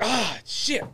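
mock-dismayed outburst on seeing trouble arrive; retold dismay. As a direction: let out a high "oh no" groan. Dreaded teacher walks back in.

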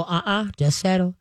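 calm, even "Uh-uh, just settle" to a jumping dog; calm low correction. Shown as a demonstration. Trainer calmly settling an excited dog, low and even. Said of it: "Just stay very calm".

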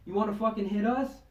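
pushy retold callout, starting loud; retold confrontation. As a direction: imitate a big guy squaring up for a fight. Acted challenge before a fight.

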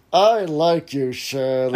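forced through gritted teeth; slow, strained, teeth clenched. Retold or imitated. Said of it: "gritting through her teeth, barely choking out the words".

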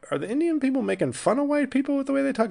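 high, quick incredulous query; incredulous question. Retold or imitated. Viewer wondering about the dialogue style, incredulous. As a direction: rush it out, high-pitched.

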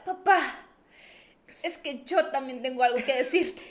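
mock-dramatic confession, loud opening call; super dramatic. Sister bursts in to confess to dad, melodramatic. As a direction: announce it like a soap-opera interruption. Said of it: "así súper dramática".

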